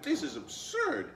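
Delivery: high, loud indignant cry; a fox losing his temper, loud and high; indignant outburst. Acted. Fox fumes at the girl, loud high outburst.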